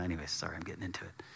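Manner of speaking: a quick real whisper, apologetic; whispered aside. Real, not acted. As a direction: drop to a whisper to excuse yourself. Self-aware aside mid-reading.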